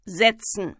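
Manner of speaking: controlled order at normal volume; terse teacher's command; curt command. A narrator's reading. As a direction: order the class down firmly. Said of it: "befahl er dann".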